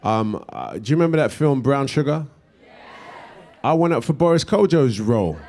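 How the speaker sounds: normal talking voice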